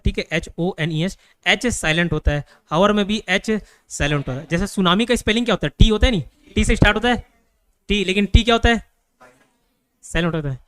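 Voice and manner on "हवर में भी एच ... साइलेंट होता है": ordinary talk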